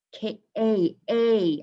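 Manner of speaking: "K A A" in slow, separate pieces; sounded-out long A. Shown as a demonstration. Teacher showing the vowel, "K A A" drawn out slowly. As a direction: stretch each "A" as the letter's name. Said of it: "I'm saying the letter A".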